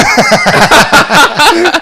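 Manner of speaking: loud laughing burst; cracking up at the story. Real, not acted. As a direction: laugh hard after the punchline. Laughing at own punchline.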